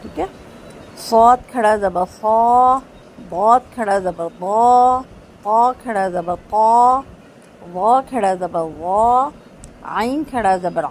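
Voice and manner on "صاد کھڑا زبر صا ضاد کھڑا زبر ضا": صا ضا full-mouthed, heavy and rounded; heavy letters. Shown as a demonstration. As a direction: fill the mouth for صاد and ضاد. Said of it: "میرے منہ کو بھر کے یہ حروف ادا ہو رہے ہیں". (حروف مستعلیہ، منہ بھر کے)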